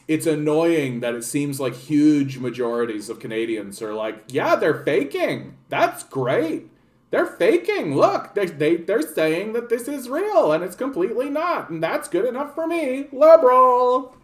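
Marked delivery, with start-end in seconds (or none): 4.3-14.2 lampoon a smug, satisfied voter; mock voter. Streamer mocks Canadians happy with fake politicians, silly high mockery.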